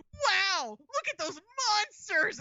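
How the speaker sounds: high, hammed-up bad game dub; Rebecca. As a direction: overact wide-eyed wonder, stretching the words. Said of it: "Rebecca turns around and goes".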